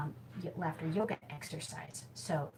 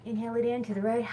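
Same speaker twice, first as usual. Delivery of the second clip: higher and louder, cheerful and fast; bright laughing cue; 6 semitones higher, 7 dB louder, faster than usual. Shown as a demonstration. Leading viewers in laughter yoga, higher and louder cheerful cue. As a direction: call the move out with a laugh in it. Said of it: "with a big hearty laugh".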